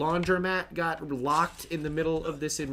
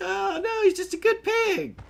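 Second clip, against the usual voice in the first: put-on very high baby voice for the pig; sweet-talking the boar in a high voice; cooing pet talk; 5 dB louder than usual. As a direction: coo at the pig like a pet owner.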